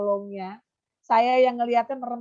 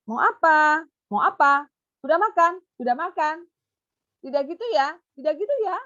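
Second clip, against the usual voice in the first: high, loud repeated questions; teacher asking a pupil simple questions; asking a child; 6 semitones higher, 5 dB louder than usual. Shown as a demonstration. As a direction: quiz the child kindly. Demo of echolalia questions.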